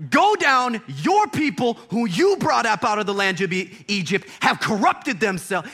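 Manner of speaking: high, loud, wounded outburst; hurt God's voice. Retold or imitated. God telling Moses his people corrupted themselves, hurt. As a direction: let the betrayal sting as you order. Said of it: "He's, like, hurt".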